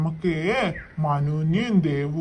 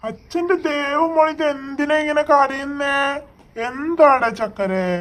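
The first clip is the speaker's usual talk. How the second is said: tender soothing; 7 semitones higher than usual. Acted. Father soothing crying daughter.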